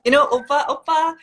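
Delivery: mock fangirl calling out to an idol; playful fan squeal. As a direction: coo oppa like an adoring fan.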